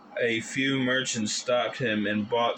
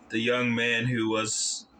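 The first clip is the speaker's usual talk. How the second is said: ordinary delivery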